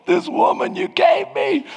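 high, strained mock shout of frustration; crying out to God. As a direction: rage at God about your wife. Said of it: "I'm crying out to God".

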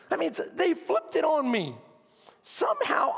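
normal talking voice

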